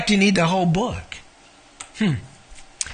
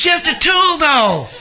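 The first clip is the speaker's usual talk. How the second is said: chuckling, pitched higher; laughing; 8 semitones higher, 3 dB louder than usual. Real, not acted. Pastor joking about a red highlighter, chuckling. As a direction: laugh it off lightly.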